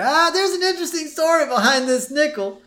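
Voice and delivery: put-on high rambling old man voice; old Grandpa voice. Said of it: "we cut to Grandpa".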